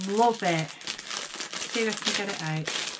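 usual speaking voice

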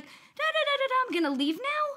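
very high, fast sing-song mockery; wife mimicking a clueless husband walking out; mocking the husband. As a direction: parody him as oblivious and breezy. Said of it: "and you're like".

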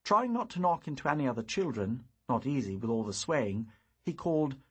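ordinary delivery, nothing marked

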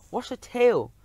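alarmed cry. A narrator's reading. About to be stepped on.

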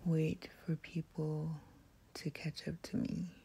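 whisper. Real, not acted. ASMR whisper into the ear.

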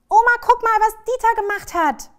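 put-on high, very loud child's outcry; alarmed call. A narrator's reading. Child shows grandma the dog's mess, loud high outcry. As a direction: cry it out, high and urgent.

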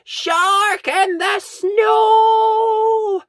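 very high, loud, drawn-out yell. A narrator's reading. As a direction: yell it across the park, slowly. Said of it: "takes a deep breath and yells".

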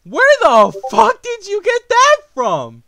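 slow, high, loud outraged yell; outraged shriek of disbelief; disbelieving scream. Real, not acted. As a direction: scream it out in shock. Reacting to zoophile accusation.

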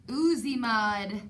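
usual speaking voice

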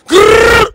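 high, loud rapper growl grrr; demo growl. Shown as a demonstration. Host demoing a rapper ad-lib, loud growl. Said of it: "Say with your chest".

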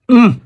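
high pleased hum of taste; savoring hum. Real, not acted. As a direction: hum with delight at the flavor. First bite, savoring.

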